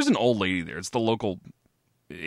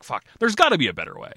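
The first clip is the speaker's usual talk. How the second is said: higher, louder, fast exasperated outburst; frustrated; 8 semitones higher, 5 dB louder, faster than usual. Retold or imitated. Scrubbing the moldy bathroom ceiling again, fast fed-up outburst. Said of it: "And I'm like".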